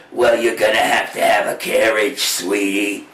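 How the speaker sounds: deep, booming fairy godmother voice. Acted. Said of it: "the fairy godmother went".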